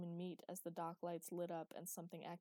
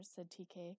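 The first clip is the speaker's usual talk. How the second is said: plain reading voice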